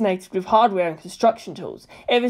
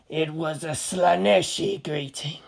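ominous Chaos Marine; 2 semitones lower, 5 dB quieter, slower than usual. A narrator's reading.

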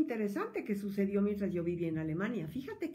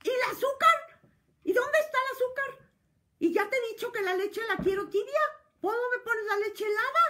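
much higher, much louder furious complaint; hysterical shriek; 8 dB louder than usual. Retold or imitated. Husband shrieking at wife over sugar and milk, hysterical furious yell. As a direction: shriek the demands like a tantrum over breakfast. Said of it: "te da otro alarido".

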